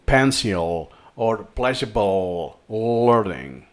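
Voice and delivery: PENCIL and PLEASURABLE slowed, with a heavy dark L. Shown as a demonstration. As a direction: draw out the L sounds in "pencil or pleasurable learning". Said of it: "remember it's a dark L".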